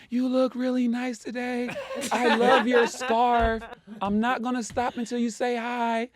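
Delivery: yelling out compliments. Retold or imitated. B G calling compliments until Sherelle says hi, high loud teasing yell. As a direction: holler sweet things to get her attention. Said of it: "I would yell out".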